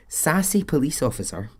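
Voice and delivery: laughing while saying it. Real, not acted. Podcaster amused by the sassy officer, laughing. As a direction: giggle through the words. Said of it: "I love this".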